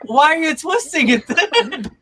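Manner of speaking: high, loud, laughing question; a lightly voiced baffled classmate. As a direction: ask it through laughter, baffled. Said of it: "People are like".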